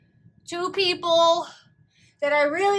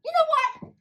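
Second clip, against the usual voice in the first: normal talking voice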